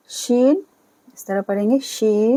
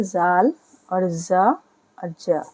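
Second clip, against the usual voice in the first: slowly drawn out, sounding ज twice; slow demonstration; much slower than usual. Shown as a demonstration. Teacher slowly drills the letter sound for students.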